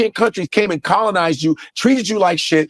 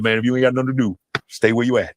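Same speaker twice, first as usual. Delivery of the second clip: lightly voiced grandma, pitched lower; 7 semitones lower, faster than usual. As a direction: pass on grandma's advice in a lower voice. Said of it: "Like my grandma said".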